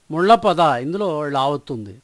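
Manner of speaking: usual speaking voice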